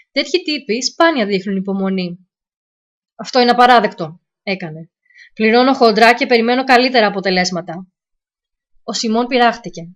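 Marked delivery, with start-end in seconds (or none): 3.1-4.2 loud, indignant client on the phone; a client snapping that this is unacceptable; angry client, loud. Acted. Impatient client angry about results. 5.3-7.9 loud, indignant client on the phone; a client snapping that this is unacceptable; angry client, loud. Acted. Impatient client angry about results.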